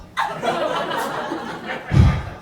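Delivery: long breathy annoyed sigh; weary sigh. Retold or imitated. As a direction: sigh like the cop can't be bothered. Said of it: "one of the cops went".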